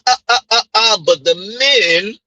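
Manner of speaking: normal talking voice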